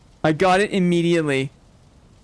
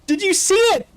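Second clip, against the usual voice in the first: excited; 11 semitones higher than usual. Real, not acted. Thrilled at discovery.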